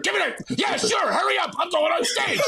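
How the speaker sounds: high, rushed mock shout of a stressed comic; impatient Sherry. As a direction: snap it out like a busy pro. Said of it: "she's like".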